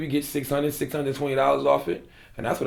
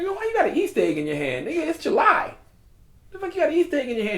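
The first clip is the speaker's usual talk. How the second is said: mock incredulous; 14 semitones higher, faster than usual. Questioning the guy with Easter egg.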